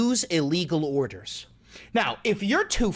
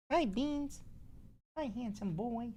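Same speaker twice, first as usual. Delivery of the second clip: pet talk; 8 semitones higher, 12 dB quieter than usual. Real, not acted. Greeting his pet.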